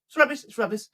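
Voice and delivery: emphatic rubbish. Acted. Repeating rubbish in bad mood.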